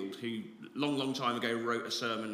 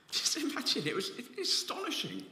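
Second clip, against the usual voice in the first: amazed laugh; 10 semitones higher than usual. Real, not acted.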